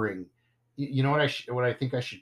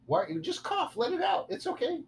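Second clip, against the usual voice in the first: high, gentle coaxing voice; sweet high voice for a coughing little one; 4 dB quieter than usual. Real, not acted. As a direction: coax the little one softly.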